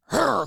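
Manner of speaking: sudden high loud startled grunt; spooked grunt. Retold or imitated. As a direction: blurt a scared hurr. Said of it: "comes out of you".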